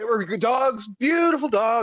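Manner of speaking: high, bright comedian quote; lightly voiced comedian bit; Shane Gillis impression. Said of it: "Shane Gillis stand up".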